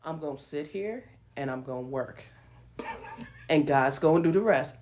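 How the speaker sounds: imitating husband's calm; calm quiet. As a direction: answer her anger with calm. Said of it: "And he said".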